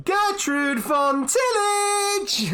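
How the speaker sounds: high, slow, drawn-out winner announcement; announcer call. Acted. Innkeeper calling out the winners to the pub, booming announcer. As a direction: stretch it out like a ring announcer. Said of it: "she calls out".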